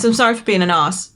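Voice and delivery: "arse" with a long posh AH. Shown as a demonstration. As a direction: say "arse" as "ahss", R silent. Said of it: "with the posh R".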